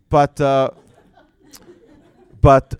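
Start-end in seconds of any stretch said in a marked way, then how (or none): none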